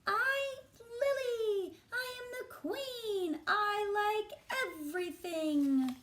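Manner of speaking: put-on high, slow, grand little girl voice; bossy little queen. Storyteller voicing Lily as a proud queen. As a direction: announce yourself like a tiny queen.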